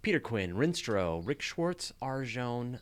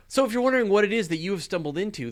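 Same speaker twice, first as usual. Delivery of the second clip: upbeat announcer; 9 semitones higher, 7 dB louder, faster than usual. Acted. Show opening announcement.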